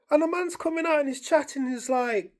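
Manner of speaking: usual speaking voice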